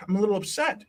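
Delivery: put-on little boy. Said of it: "he's very little".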